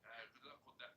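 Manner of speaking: soft try at her London accent; quietly trying out the London accent; mimicking her accent. Shown as a demonstration. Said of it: "I'd have to hit your tone".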